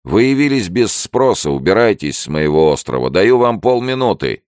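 loud, high-pitched threat; angry ultimatum. A narrator's reading. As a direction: order the intruder off your island. Stranger orders intruder off island. (гневный ультиматум)

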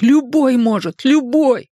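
very high-pitched panicked cry; terrified cry. A narrator's reading. Richie's mind realises anyone can die, terrified cry. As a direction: cry it out high in wild horror. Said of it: "в диком ужасе закричал его рассудок".

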